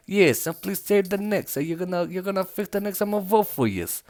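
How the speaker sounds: a mock New Yorker, muttered half under the breath; terrible New York accent. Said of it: "Terrible New York accent".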